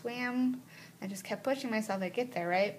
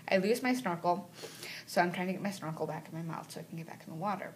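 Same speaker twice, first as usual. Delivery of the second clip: normal talking voice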